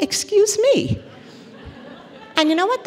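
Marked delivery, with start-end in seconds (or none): 0.0-1.0 indignant. Retold or imitated. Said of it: "I was like".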